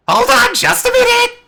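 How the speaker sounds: very high, loud squawky holler; high-pitched creature yell; shouting fey creature. Acted. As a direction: call out from the gate in a piercing creature voice. Gate creature calls out to party.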